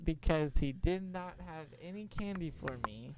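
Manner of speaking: ordinary delivery, nothing marked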